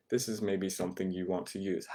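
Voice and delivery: ordinary talk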